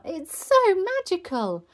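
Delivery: put-on high, loud child's delight; excited little-girl cry; gleeful shout. A narrator's reading. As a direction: exclaim with thrilled wonder. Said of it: "shouted Peppa".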